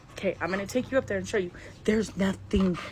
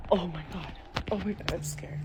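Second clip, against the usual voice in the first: frightened hushed whisper, barely voiced; scared whisper; 3 semitones lower, 7 dB quieter than usual. Real, not acted. Whispering to viewers, scared by a sound upstairs. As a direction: whisper in fear after hearing a noise.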